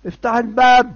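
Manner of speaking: high, loud call through a door. Acted. Man at the door wakes the sleeper, calling out loudly. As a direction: shout to rouse a sleeper.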